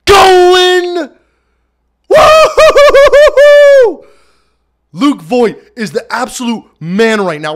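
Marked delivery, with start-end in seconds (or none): 0.0-1.1 much higher and louder, cry-like holler; real shocked yell. Real, not acted. Yelling while drenched with water. 2.1-4.1 very high, slow, laughing woo-hoo scream; wild whooping. Real, not acted. Screaming after water dump.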